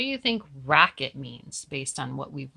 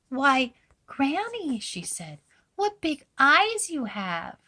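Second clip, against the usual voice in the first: put-on little girl voice; 10 semitones higher, 4 dB louder than usual. A narrator's reading. Girl questioning disguised wolf.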